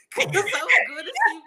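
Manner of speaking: high loud laughter through the words; laughing helplessly; laughing hard. Real, not acted. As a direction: crack up while explaining. Laughing over embarrassing answer.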